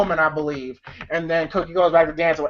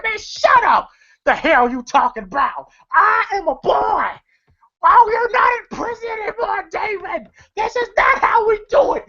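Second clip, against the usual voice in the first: high-pitched hammed-up tirade; mock angry scolding; 12 semitones higher than usual. Money Mike slapping and scolding Damon, mock angry and high. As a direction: tell him off in shrieky comic outrage.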